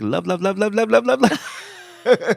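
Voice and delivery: rapid-fire chain climbing high in pitch; teasing high-pitched stutter of one word; strung-together repetition. Retold or imitated. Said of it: "sometimes she will string together".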